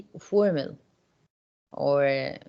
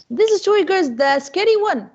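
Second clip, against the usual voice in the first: loud, high, excited prompt; urgent prompting; 12 semitones higher, 6 dB louder than usual. Real, not acted. Teacher asking girls for the scary author, urgent prompting. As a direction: push the class to remember.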